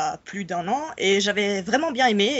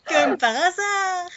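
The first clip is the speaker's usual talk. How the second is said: sarcastic; 7 semitones higher, slower than usual. Real, not acted. Mock surprise at being picked.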